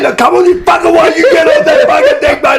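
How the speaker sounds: hammed-up furious dad yelling, half laughing; mock angry yell. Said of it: "I was getting angry at him".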